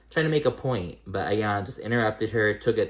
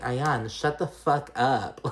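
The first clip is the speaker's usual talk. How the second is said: slow, drawn-out imitation of Stanbury snapping at Ayan; mock telling off; 4 semitones higher, much slower than usual. Quoting Stanbury telling Ayan off, a slow mocking shut-up. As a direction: drag each word out, fed up. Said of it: "Just like Caroline Stanbury said".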